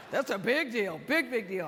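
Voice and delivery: loud, laughing mock Barkley; Charles Barkley impressed. Barkley hearing about her award, loud laughing mock. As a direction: ham up his big impressed reaction. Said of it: "He goes".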